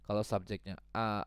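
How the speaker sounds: ordinary talk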